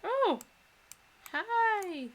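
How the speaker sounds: high, delighted greeting to a cat; excited greeting. Real, not acted. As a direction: squeal hello at the kitty.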